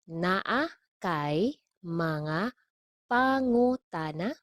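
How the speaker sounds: very slow, separated words, rising at the end; slow model. Shown as a demonstration. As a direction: spell out "Naa kay mga pangutana" chunk by chunk. Teacher models phrase slowly, then normally.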